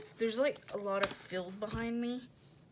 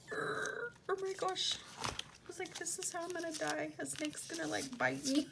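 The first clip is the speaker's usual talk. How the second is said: high, thin, panicky retelling; mock dread; 8 semitones higher than usual. Telling how she thought the snake would bite her, mock dread. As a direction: fret in a much higher voice.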